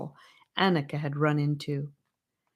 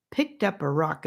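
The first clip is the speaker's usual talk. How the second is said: ordinary delivery, nothing marked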